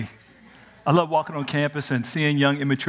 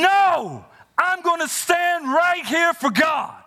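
defiant stand; much slower than usual. Acted. Student taking a stand for God, high and slow. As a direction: plant your feet and proclaim it, slow and high.

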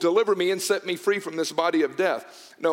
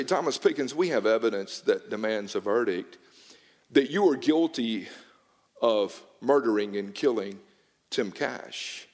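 a judge pronouncing guilt, pitched lower; stern judge; 7 semitones lower than usual. Retold or imitated. Judge tells the accused his murder verdict, low and grave. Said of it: "and he would say".

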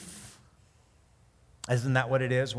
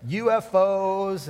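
slow, drawn-out and loud, higher; spacing out U F Os in amused scorn; mocking incredulous; 6 semitones higher, 7 dB louder, much slower than usual. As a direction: spell out the letters with mock disbelief. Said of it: "And I was like".

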